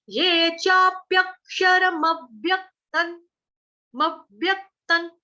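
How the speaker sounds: slow ये चाप्यक्षरम अव्यक्तन with half न; slow teacher demo; careful recitation. Shown as a demonstration. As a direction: spell out मव्यक्तन slowly. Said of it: "हलंत न".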